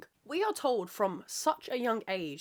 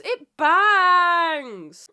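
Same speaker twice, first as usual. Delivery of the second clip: gushing; 7 semitones higher, 12 dB louder than usual. Real, not acted. Gushing over salad sauce.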